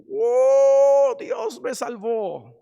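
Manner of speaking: loud high "oh", easing off after; jubilant shouting. Acted. Addict just saved at Jesus's feet, jubilant shouting. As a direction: cry out like someone just rescued. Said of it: "Va a estar gritando y diciendo".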